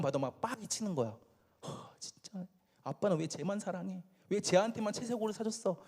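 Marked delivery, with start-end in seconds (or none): 1.7-5.8 soft, sighing jealous grumble; hushed mock exasperation with a huff; acted resentment. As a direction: grumble under your breath as a slighted brother. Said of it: "막 화가 나니까".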